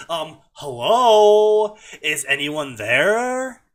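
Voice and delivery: put-on slow, loud, drawn-out Valley Girl; attempted Valley Girl. Naruto calling into the cage room, rough Valley Girl. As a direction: stretch the vowels like a badly faked Valley Girl. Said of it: "trying for a Valley Girl".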